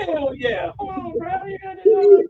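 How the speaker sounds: put-on little girl voice. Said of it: "She said".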